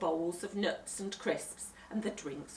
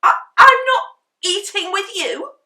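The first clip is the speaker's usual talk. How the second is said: high, loud stammered disbelief; stuttering in shock; 13 semitones higher, 22 dB louder than usual. A narrator's reading. Henry hearing he goes to bed, stammering high. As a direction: stammer out the outrage. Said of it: "Henry stuttered".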